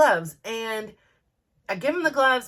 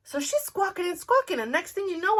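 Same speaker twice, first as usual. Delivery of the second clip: usual speaking voice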